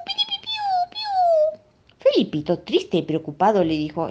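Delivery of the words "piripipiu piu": very high mournful chirp; sad birdsong. A narrator's reading. Little bird chirps sadly to comfort crying Felipito. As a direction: chirp as if weeping along. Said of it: "te estoy ayudando a llorar".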